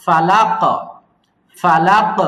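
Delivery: ফালাক with a hard bouncing final ক; ফালাক, firm qalqala at the end; strong qalqala. Shown as a demonstration. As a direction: snap the final ক of ফালাক with an echo. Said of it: "শক্ত করে বলতে হবে কলকলাটা".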